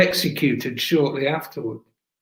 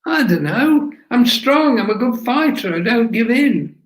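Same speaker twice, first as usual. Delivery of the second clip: higher, louder eager insistence; 8 semitones higher, 3 dB louder than usual. A narrator's reading. Sydney insisting to Gabriella that he's strong, eager and higher. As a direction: push hard to prove himself.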